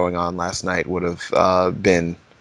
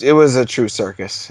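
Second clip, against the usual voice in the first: ordinary talk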